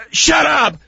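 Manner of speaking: normal talking voice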